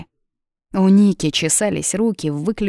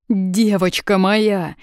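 drawn-out, anguished cry to his daughter; mildly sobbing, stretched words; tearful father; 3 semitones higher, 3 dB louder, much slower than usual. A narrator's reading. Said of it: "зарыдал он в трубку".

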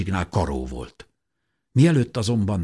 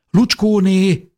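high, loud, long drawn-out name; urgent calling; 12 semitones higher, 8 dB louder, much slower than usual. A narrator's reading. Mother knocks at neighbour's house, urgent calling. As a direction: call through a closed door. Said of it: "kopogtatott édesanyám sürgetően".

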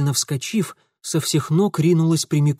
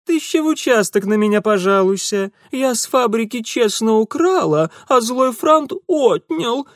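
put-on affected squeaky girl voice, higher and louder; mock girlish squeak; 9 semitones higher, 4 dB louder than usual. A narrator's reading. Thief mocks the girl, affected girlish squeak. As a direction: mince it like a prissy girl. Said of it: "пропищал он делано девичьим жеманным голоском".

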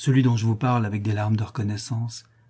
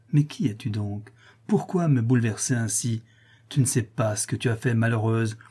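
ordinary delivery, nothing marked

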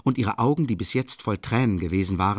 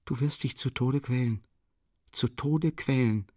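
higher, slowing plea; despairing; 5 semitones higher than usual. A narrator's reading. Sonja warning him of his torment, despairing. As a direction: let the repeated words drag out in despair. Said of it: "wiederholte sie verzweifelt".

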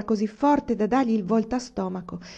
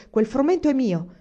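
loud, raised, emphatic assertion; angry insistence; 5 semitones higher, 5 dB louder than usual. A narrator's reading. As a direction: lay down the law, pounding each point. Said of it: "Picchiò il pugno sul tavolo e disse".

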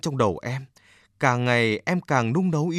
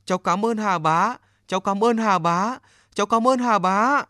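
each thank-you higher and louder than the last; growing gratitude; 8 semitones higher, 4 dB louder than usual. A narrator's reading. Boy bowing three times to the river god, thanks swelling louder and higher. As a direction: build from grateful to overjoyed. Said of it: "cúi đầu ba lần, miệng nói".

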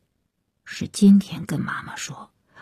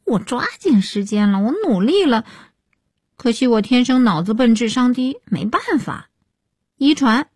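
higher-pitched, sulky self-defence; low-volume muttered retort; 6 semitones higher than usual. A narrator's reading. Daughter blames heredity for bad marks, muttering back. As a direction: answer back in a high small voice. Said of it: "小声说".

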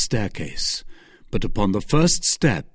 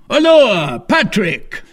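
loud, high summons; raised, alarmed call; calling for help; 11 semitones higher, 7 dB louder, slower than usual. A narrator's reading. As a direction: shout for a servant. Duke calls for his servant.

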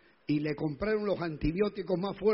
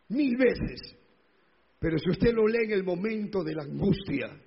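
preaching at full force; 3 semitones higher, 5 dB louder than usual. Acted. Preacher exhorting the brethren.

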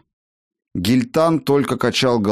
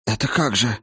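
hoarse. A narrator's reading. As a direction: barely force the words out in shock. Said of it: "сипло выдавил он".

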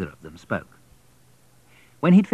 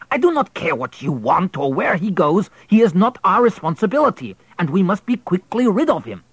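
raised, higher, urgent man's voice; louder, pressing the wife to get rid of him; a husband insisting in a raised voice; Pyotr, urgent husband; 9 semitones higher, 6 dB louder than usual. Acted.

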